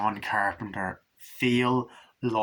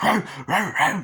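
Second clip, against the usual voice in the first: high, loud mock barking; imitated dog bark; 7 semitones higher, 6 dB louder than usual. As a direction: bark like the kennel dogs. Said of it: "they put in the dogs".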